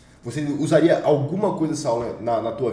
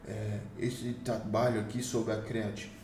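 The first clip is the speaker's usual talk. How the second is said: softer, slow and hesitant, trailing; unsure delivery; 2 semitones lower, 8 dB quieter than usual. Shown as a demonstration. Coach showing students how an insecure speaker sounds, timid and soft. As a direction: mumble it like you doubt yourself. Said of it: "Então eu falo aqui, ó".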